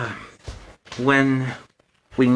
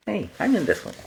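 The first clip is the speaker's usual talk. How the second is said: bright, higher surprised delight; delighted discovery; pleased surprise; 8 semitones higher, faster than usual. Real, not acted. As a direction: sound tickled to find yourself in the story. Finds himself in the book.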